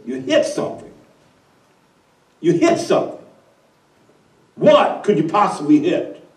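can't believe what he hears; devil in disbelief. Acted. The devil questions the wind in disbelief, incredulous.

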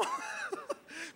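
high-pitched burst of laughter. Real, not acted. Preacher laughs at his joke about the dead man talking, high. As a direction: laugh at your own joke.

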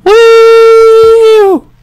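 high loud victory whoop; triumphant shout. Real, not acted. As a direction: yell like you just won. Winning the level.

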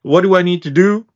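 ordinary talk, nothing marked

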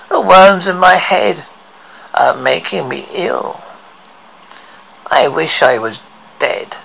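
plain reading voice

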